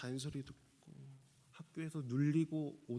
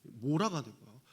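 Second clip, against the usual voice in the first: normal talking voice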